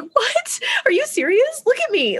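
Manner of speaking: very high-pitched incredulous outburst; acted indignation. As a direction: replay an offended, disbelieving squawk. Acted quarrel over the dogs.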